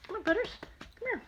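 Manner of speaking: high, quick call to the dog. Real, not acted. Grandma calling the dog over to join, high call.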